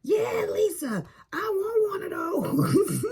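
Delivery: yelling from the background. Retold or imitated. Son-in-law hollering to mother-in-law from the background, high imitated yell. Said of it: "Ralph yells in the background".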